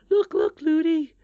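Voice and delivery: put-on very high, loud child's call; excited princess. A narrator's reading. Princess calls to running nurse.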